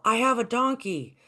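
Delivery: boy calling to the village, joyful mock shout; excited shouting. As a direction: shout the news to the whole village. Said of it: "screaming out".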